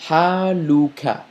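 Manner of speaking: "ha, lu, ka" read together as one word; Arabic syllables read together. Shown as a demonstration. Teacher reading the syllables together as a word. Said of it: "reading this all together".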